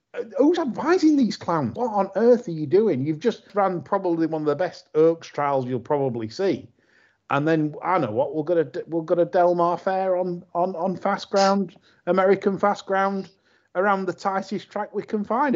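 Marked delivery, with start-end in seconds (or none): 0.1-4.9 exasperated rant. Real, not acted. Ranting at the filly's owners. 7.3-15.6 mocking the owners.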